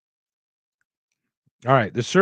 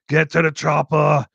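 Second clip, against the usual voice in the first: "Get to the Chopper" barked louder in a lightly put-on action-star voice; action hero impression; 4 semitones higher, 5 dB louder than usual. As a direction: shout it like an eighties action hero. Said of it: "grew up on Rambos".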